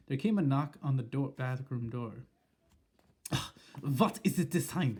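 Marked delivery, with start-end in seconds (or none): 3.3-5.0 shouted. Acted. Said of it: "shouted the Grand High Witch".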